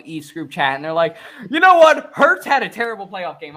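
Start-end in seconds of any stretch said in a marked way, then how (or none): 1.5-3.6 loud, high hammed-up country twang; country accent. Mocking Dallas fans in a group chat, exaggerated country accent. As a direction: put on your best country accent, jumping high at the start. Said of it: "that's my best country accent".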